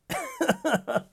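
high short laugh; delighted laugh. Real, not acted. Asks friends if they understood, high delighted laugh. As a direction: laugh high at your own insight.

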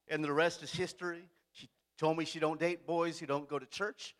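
ordinary delivery

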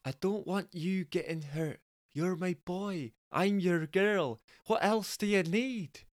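Scottish falsetto rising as she pleads; Scottish woman. Acted. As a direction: plead tenderly as a Scottish girlfriend. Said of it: "Jessica is actually Scottish".

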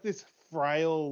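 ordinary talk

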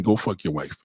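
ordinary talk

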